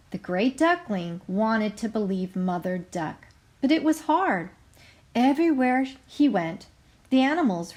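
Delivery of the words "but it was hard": ordinary talk, nothing marked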